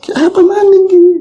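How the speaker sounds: startled exclamation. Retold or imitated. Man finds a lump in his trousers, startled high cry. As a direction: jump at the strange find.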